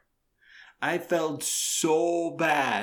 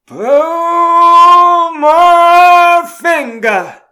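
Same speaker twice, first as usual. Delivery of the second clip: high, loud, very slow Southern drawl; 19 dB louder, much slower than usual. Retold or imitated. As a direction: stretch every word in a hammed-up Southern accent. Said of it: "in the worst fake Southern accent she could possibly conjure up, and with the longest drawl".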